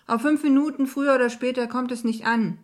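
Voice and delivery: loud parting shout, lightly acted; calling after someone. A narrator's reading. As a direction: call it out after them as they rush off. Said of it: "rief er mir nach".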